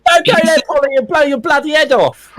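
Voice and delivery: put-on high, broad London accent. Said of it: "she's London accent".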